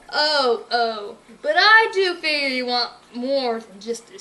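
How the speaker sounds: slow, gloating villain with a chuckle. Acted. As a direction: relish the threat.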